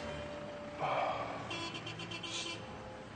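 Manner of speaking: long, soft exhaled sigh; weary sigh. Real, not acted. Traveller fed up with horrendous traffic, sighing. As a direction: let out a tired breath.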